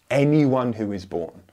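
ordinary talk

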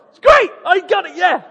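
high, loud burst of triumph; gleeful celebration. Real, not acted. Storyteller celebrating getting off with a warning, gleeful shout. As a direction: cheer your own lucky escape.

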